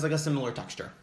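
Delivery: ordinary talk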